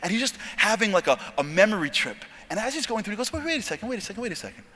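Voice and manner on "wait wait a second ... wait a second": soft, quick repeated self-interruption; dawning realization. Retold or imitated. Trainee remembering something that night, hushed dawning realization. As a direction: catch a thought, hushed. Sudden realization while reminiscing.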